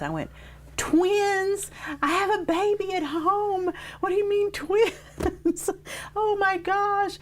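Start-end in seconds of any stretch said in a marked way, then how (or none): 0.8-5.7 stunned mother-to-be crying out at the twins news; shocked disbelief. Retold or imitated. 5.7-7.2 very high, laughing "oh my gosh"; stunned laugh. Retold or imitated. Laughing after the twins shock.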